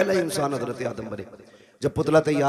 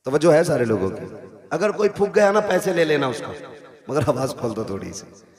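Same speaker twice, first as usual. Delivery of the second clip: usual speaking voice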